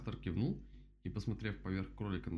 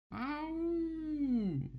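long high-pitched howl; 4 dB louder than usual. A narrator's reading. Rabbit hears the bad news, high howl. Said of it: "взвыл кролик".

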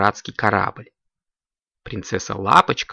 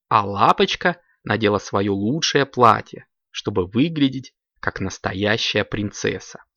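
usual speaking voice

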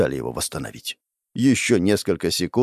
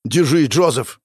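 raised, forceful cry; urgent battle call; 4 semitones higher, 3 dB louder than usual. Acted. Hero racing toward Joseph, urgent raised call. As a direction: call out to a struggling partner.

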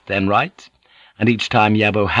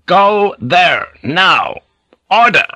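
high, loud, insistent orders; forceful, punched-out commands; shouted command; 9 semitones higher, 6 dB louder, slower than usual. A narrator's reading. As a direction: shout each order with full authority. Repeats command to hesitating men.

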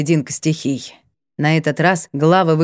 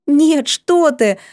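excited; 7 semitones higher, much slower than usual. Acted. Nalanta exclaims, waving hands.

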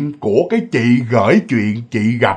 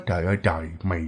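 low, much softer grumble; muttering under breath; 9 semitones lower, 12 dB quieter, much faster than usual. Retold or imitated. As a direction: mumble it to yourself on the way out. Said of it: "ổng lầm bầm trong miệng".

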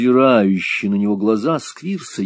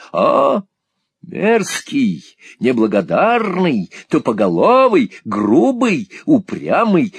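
high, loud scolding tirade; indignant villainess berating a boy; shrewish exclaiming; 4 semitones higher, 6 dB louder, slower than usual. A narrator's reading. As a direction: hurl each insult separately, high and loud. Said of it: "воскликнула миссис Сквирс".

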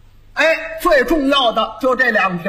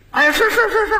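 eager fawning yes; 11 semitones higher, faster than usual. Acted. Fawning restaurant owner agrees.

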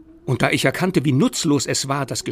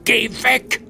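higher, loud terrified yell; shouting; 12 semitones higher, 6 dB louder than usual. Acted. Lockwood screams at the ghost child in terror, shouting. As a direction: scream it at the thing outside. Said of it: "schrie ich".

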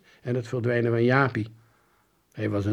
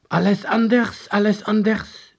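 high, loud, agitated voice; confused man calling; 9 semitones higher, 6 dB louder than usual. A narrator's reading. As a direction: call it out in bewilderment, loud and high. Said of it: "riep de man verward".

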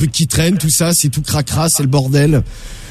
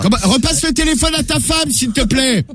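much higher, raised voice; to someone hard of hearing; 7 semitones higher than usual. Acted. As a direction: call out so a deaf man hears. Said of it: "je vais pas me péter une corde vocale".

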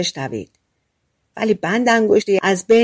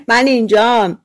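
louder, cheeky call-out; 3 semitones higher, 5 dB louder than usual. A narrator's reading. Boy on the table taunting his pursuer, loud call. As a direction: pipe up loudly as a teasing boy. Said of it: "با صدای بلند گفت".

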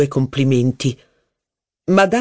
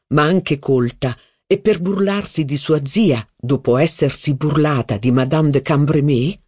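usual speaking voice

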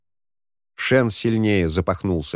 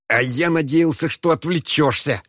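complaining; 7 semitones higher than usual. Acted. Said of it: "посетовал он".

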